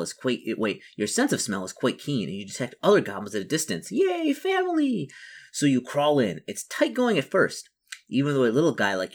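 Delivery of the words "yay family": drawn-out, high-pitched yay; mock-excited cheer. Goblin detects other goblins in the distance, mock-excited cheer. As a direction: squeal a long, playful cheer. Goblin greets discovered kin.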